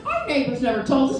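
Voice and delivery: ordinary talk, nothing marked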